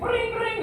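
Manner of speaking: high sing-song ring ring; imitated phone ring. Waiting for the call to connect, imitating the ring high. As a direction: mimic the phone ringing up high. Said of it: "the phone is like".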